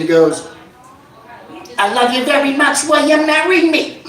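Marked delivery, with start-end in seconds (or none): 1.8-4.0 high-pitched rapper proposing marriage; B-Real. Acted. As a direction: pop the question in a high put-on voice. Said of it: "B-Real looks up at his".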